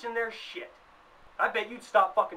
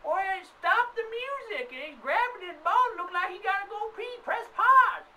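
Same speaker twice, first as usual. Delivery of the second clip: put-on high, slow old lady voice, raised higher, then louder on the last words; much higher, slow fussing old woman; 13 semitones higher, 5 dB louder, slower than usual. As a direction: squeak it out like a scandalized granny. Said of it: "an old lady in the freaking audience going".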